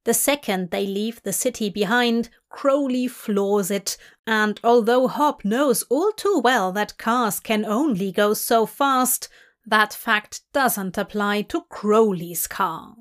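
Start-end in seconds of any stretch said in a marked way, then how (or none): none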